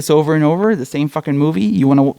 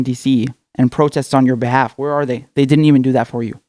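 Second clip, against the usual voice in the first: ordinary delivery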